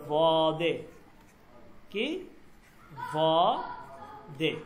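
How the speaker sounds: বদে set apart and drawn out slowly; second part shown. Shown as a demonstration. Teacher shows the second word to students. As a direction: hold বদে long so it sticks. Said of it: "শব্দটা হচ্ছে এইভাবে".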